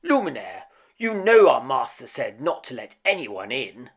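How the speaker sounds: loud, stern scolding; loud stern rebuke from the clock. A narrator's reading. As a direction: scold him like a fussy clock.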